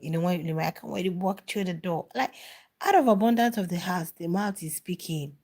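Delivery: ordinary delivery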